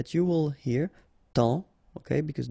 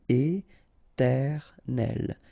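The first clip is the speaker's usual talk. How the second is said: very slow "éternel", é-ter-nel; syllable by syllable; much slower than usual. Shown as a demonstration. French teacher modelling for learners, "éternel" slowly syllable by syllable. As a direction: pause between each syllable of "éternel".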